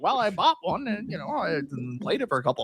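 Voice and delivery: high start, dipping, then swooping up high at the end; mock cocky amateur. Overconfident buyer claiming he can build machines now, mock cockiness. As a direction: parody naive self-assurance. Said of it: "the hubris to be like".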